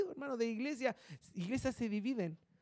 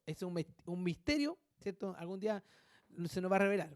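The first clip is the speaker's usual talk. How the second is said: normal talking voice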